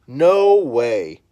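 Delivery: loud, outraged brothers' cry; outraged shout. Acted. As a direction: shout it in disbelief. Brothers protesting in story.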